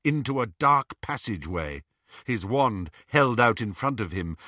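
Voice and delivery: ordinary delivery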